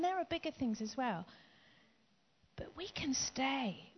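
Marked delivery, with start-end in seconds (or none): 2.6-3.9 soft, slow whispered reassurance. Acted. Preacher whispers comfort to his congregation, slow. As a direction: hush it, slowly, to draw them in. Quiet turn after bigger things.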